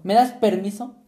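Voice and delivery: snippy request. Retold or imitated. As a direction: ask it with a bit of attitude. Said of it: "más pinche tono". (petición cortante)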